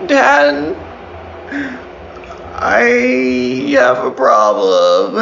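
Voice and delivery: high, very slow mock crying voice; imitating a whiny crying child; crying, worn out. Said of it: "That's not what I sound like when I'm crying".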